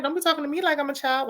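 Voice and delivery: high, fast, indignant snap-back; sassy comeback. Pretend. Vlogger imagines telling a cast member off, high and fast. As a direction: play yourself putting her in her place. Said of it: "And I'm like".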